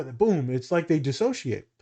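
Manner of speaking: normal talking voice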